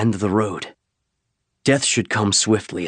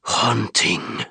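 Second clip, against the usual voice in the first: deeper and sinister; 2 semitones higher than usual. Acted. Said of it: "His voice dropped even deeper and more sinister".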